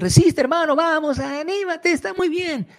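very high, sing-song hollow cheer; empty encouragement. Retold or imitated. Preacher mimicking hollow encouragement to a brother, high and sing-song. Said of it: "te puedo decir".

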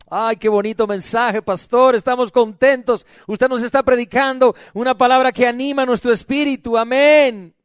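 loud, imitated congregational delight; mock gushing praise. Preacher imitating flock happy with pastor's message, mock gushing praise. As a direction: gush it as a pleased flock would.

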